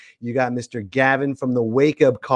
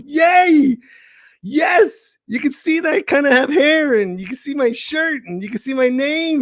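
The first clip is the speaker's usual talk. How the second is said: bubbling over with excitement; excited. Real, not acted. Host hyped about live stream.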